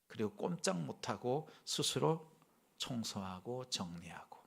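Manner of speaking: usual speaking voice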